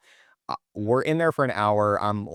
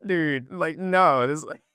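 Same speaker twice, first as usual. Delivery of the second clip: laughing exasperation; 6 semitones higher than usual. Retold or imitated. And I'm just like.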